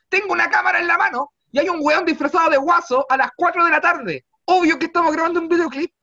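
high, loud exasperated rant; yelling back at the guard in disbelief; exasperated retort. Retold or imitated. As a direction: shout the obvious at the guard.

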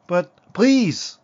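loud, high-pitched plea; desperate pleading. A narrator's reading. Husband begging his wife to listen, desperate. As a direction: beg her not to send you away.

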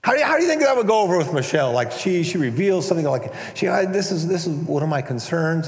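ordinary talk, nothing marked

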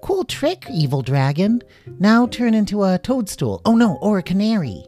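high, loud, eager demands; excited child. Acted.

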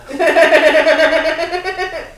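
loud, high burst of laughter; real laughter. Real, not acted. Laughing at co-host's accent remark.

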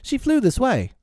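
high, puzzled insistence; becoming troubled. A narrator's reading. Peter searching for Wendy among the boys, troubled. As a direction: insist high, with rising concern. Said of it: "becoming troubled".

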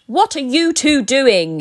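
loud, slow angry bellow. A narrator's reading. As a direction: bellow at the caught elves. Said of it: "he bellowed".

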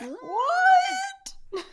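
long high stretched "what"; drawn-out disbelief; shocked. Real, not acted. Shocked at last book.

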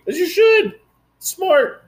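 As you should squeaked way up high, pleased; high-pitched delighted approval; high approving praise. Real, not acted. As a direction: cheer him on in a high, bright squeal of approval. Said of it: "approving the friend".

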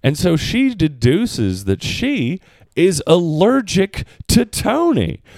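ordinary delivery

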